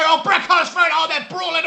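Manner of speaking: loud, high excited play-by-play; wrestling commentator erupting; excited call. Real, not acted. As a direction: call the brawl at full volume.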